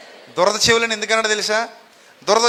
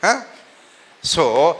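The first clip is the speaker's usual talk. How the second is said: usual speaking voice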